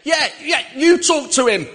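high, loud imitated shout; mock tipsy shout. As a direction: push the phone over with a shout. Drunk man pushes phone over.

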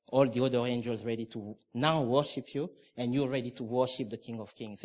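normal talking voice

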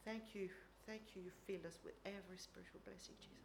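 hushed reflective prayer; quiet personal prayer. Real, not acted. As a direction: pray it softly to yourself. Private prayer during a pause.

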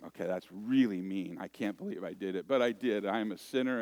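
ordinary talk

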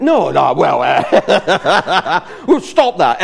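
loud, high laughing protest. Real, not acted. Laughing as she tells the congregation her reply. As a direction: laugh it off, breaking up.

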